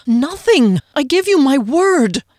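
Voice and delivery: loud, high protest; accused man protesting loudly. Acted. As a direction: plead innocence forcefully. Frederick protests.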